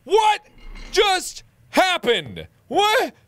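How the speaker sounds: very high, loud squawking disbelief; shocked disbelief. Real, not acted. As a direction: shriek it in bewildered protest. Shocked at sudden game failure.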